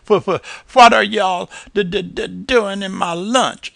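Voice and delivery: high, loud stammering critter; stuttering possum. A narrator's reading.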